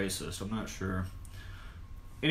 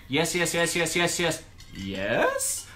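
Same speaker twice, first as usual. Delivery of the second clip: rapid yeses, the last "yes" drawn out and rising; questioning yes; 6 semitones higher, slower than usual. Retold or imitated. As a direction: turn the final "yes" into an unsure question. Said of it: "it's not a fucking question".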